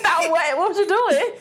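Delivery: high-pitched amused questioning; laughing. Real, not acted. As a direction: ask it through real laughter. Cracking up at friend's imitation.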